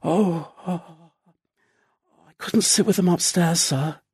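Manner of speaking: soft, hesitant stammer; confused stammer. Acted. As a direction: stammer it out, startled and unsure. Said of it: "with a start and a confused stammer".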